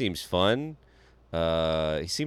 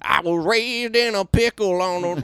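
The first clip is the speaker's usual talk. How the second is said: mock folksy singer; 10 semitones higher, 4 dB louder than usual. Mocking Californian band.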